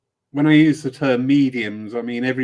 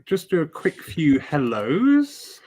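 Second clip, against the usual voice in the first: normal talking voice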